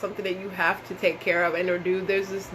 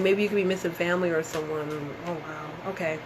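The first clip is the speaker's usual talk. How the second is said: ordinary delivery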